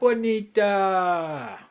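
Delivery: long drawn-out shout of a name; calling out. Real, not acted. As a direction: call her name loud and slow. Calling out to a friend.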